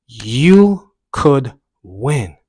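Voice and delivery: very slow, loud "You ... could ... win"; drawn-out encouragement. Retold or imitated. Runner repeating his mantra mid-run, slowly emphatic. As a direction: stretch every word out with force. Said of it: "the voice kinda changed".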